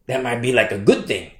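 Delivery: ordinary delivery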